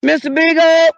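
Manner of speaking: loud, high holler; calling out. Pretend. Owner calling out for lost dog, loud high holler. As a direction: holler for the dog, holding the last syllable.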